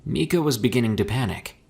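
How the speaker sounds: usual speaking voice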